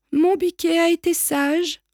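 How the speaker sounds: high-pitched doting mother; mother's voice. A narrator's reading. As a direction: coo it like a proud mum. Mother goat greeting, acted voice.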